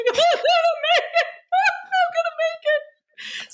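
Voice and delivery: half laugh, half cry the words out high; retold laugh-crying at the finish line; ugly crying. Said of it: "ugly crying at the finish line".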